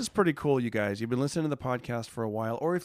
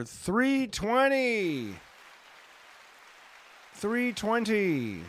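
proud; 9 semitones higher, much slower than usual. Real, not acted. Proud chart ranking.

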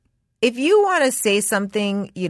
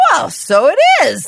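high, loud hammed-up gentleman; mock posh "so it is"; posh gent; 6 semitones higher, 7 dB louder than usual. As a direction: play a gracious old-fashioned gent. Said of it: "I might be like".

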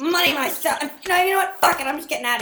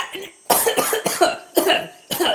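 rough cough to clear the throat; clearing his throat mid-protest; 6 semitones higher than usual. Acted. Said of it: "I just gotta clear my throat".

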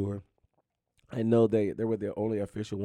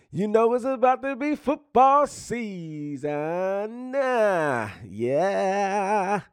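hype call; 10 semitones higher, 6 dB louder, much slower than usual. Real, not acted. Hyping the coming football season.

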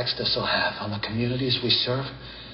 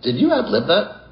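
surprised; 6 semitones higher, 7 dB louder than usual. Acted. Reacts to improvised prayer.

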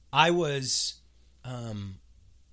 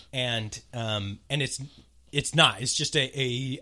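normal talking voice

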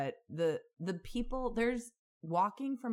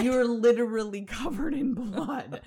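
slow, loud, laughing through the words; laughing disbelief; 5 semitones higher, 7 dB louder, slower than usual. Real, not acted. Laughing to her cohost about the bloody man. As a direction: crack up while stressing it. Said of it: "laughing disbelief".

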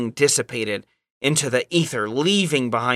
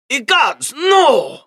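high cry of mock horror, Russian accent; hammed-up alarm; 10 semitones higher, 4 dB louder, much slower than usual. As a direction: build to a loud, high "no!". Uncle attacked while leaving voicemail.

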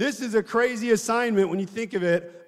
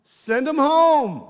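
disciples voiced; 7 semitones higher, 5 dB louder, much slower than usual. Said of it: "Remember the feeding of the five thousand".

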